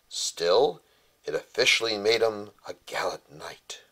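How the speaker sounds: rhyming storybook read aloud with fuller voice; bedtime story narration. Acted. Father reading a rhyming tale to his son, storytelling voice.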